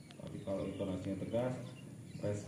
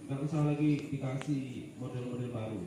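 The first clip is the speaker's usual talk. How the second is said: ordinary talk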